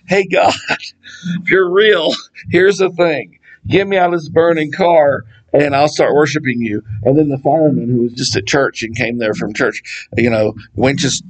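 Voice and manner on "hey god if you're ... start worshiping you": mock desperate prayer. Atheist praying in burning car.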